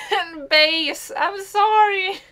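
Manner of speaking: loud, high, slow apology; mock wailing apology. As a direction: cry it out like a dramatic sorry. Apologetic wail, louder.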